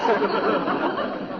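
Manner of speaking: a short laugh at her jab; laughing aloud; amused laugh. Real, not acted. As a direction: chuckle it off. Laughs at wife's sarcasm.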